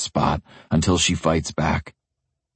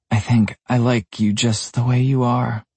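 higher, light female voice; young woman voice; 7 semitones higher than usual. Acted. Michaela answers Evan.

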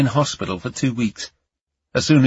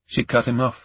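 ordinary delivery

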